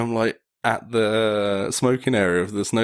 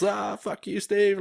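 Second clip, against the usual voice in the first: mock yell across slopes; 10 semitones higher, slower than usual. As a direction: call out a joking insult to a mate. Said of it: "screaming across".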